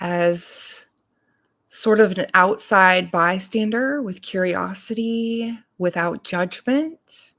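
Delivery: unhurried meditation voice, long pauses between phrases; guided meditation. Real, not acted. Reverend leading morning listeners into breath and body awareness, guided meditation. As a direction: guide them calmly, leaving space to breathe.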